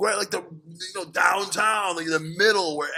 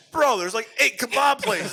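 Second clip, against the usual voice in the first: higher, louder, incredulous bro; exasperated friend; 9 semitones higher, 4 dB louder than usual. Retold or imitated. The friend on the phone telling him the street is full of kebab places, exasperated. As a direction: point out the obvious, fed up and pitched up.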